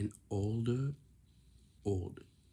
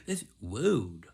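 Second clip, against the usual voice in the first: ordinary talk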